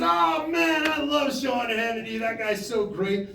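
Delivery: high, loud gush of enthusiasm; big effusive exclamation in imitation; excited Trump. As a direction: gush loudly, stretching out the last words. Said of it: "And Trump goes".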